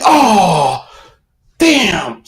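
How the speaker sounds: a sinking oh-damn; dismayed. Retold or imitated. Price fell again.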